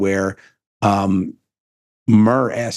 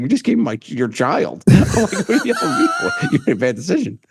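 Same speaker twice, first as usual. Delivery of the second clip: high-pitched, laughing incredulity; squeaky mock outrage breaking into a laugh; amused retold protest; 11 semitones higher than usual. Retold protest at angry mother, laughing.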